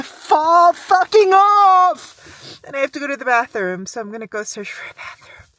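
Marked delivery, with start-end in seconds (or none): 0.0-2.6 loud, slow freezing gripe; fed-up shout; loud complaint. Real, not acted. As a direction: drag it out, frozen. Complaining of frozen fingers.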